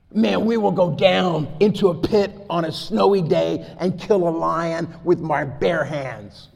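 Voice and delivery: loud, high, driving sermon delivery; preaching at full force. Acted. Preacher rousing his church with stories of bold faith, full-force preaching. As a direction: fire up the congregation.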